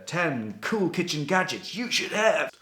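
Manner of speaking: high, slow, mock headline read; clickbait headline voice. Writer mocking her own blog article titles, slow high headline voice. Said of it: "blog articles like".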